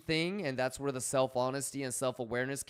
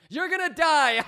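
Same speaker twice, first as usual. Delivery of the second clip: a doctor shouting high and loud; mock doctor; 14 semitones higher, 13 dB louder than usual. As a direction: blurt the bad news like a panicked doctor. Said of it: "you wouldn't want the same thing for a doctor".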